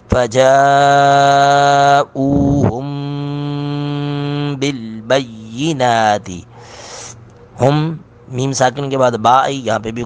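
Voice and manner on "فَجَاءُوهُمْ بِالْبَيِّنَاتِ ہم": slow drawn-out melodic tilawat; Quran recitation. Acted. Teacher reciting the verse aloud, melodic. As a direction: chant it long and melodic.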